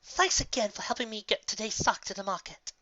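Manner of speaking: a hint of a cheerful farmer; lightly voiced grateful mare. Farmer pony thanks her friend for helping, lightly voiced. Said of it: "said the golden-coated Earth Pony mare".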